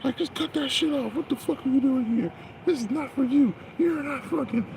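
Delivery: high, mocking voice of a hater; mock the hostile commenter up high; mocking the guy. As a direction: sneer it up high like the guy in the video. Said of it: "the guy was like".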